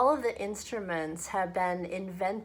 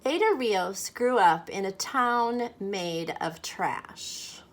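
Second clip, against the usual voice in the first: lively, louder storybook voice; story reading to children; 5 dB louder than usual. A narrator's reading. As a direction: read it aloud like a picture book for kids. Reads picture book aloud to children.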